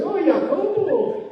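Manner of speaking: high, loud, drawn-out sweet greeting; fawning neighbors. Retold or imitated. Neighbors calling to the rich man, loud and sugary. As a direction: stretch his name out sweetly.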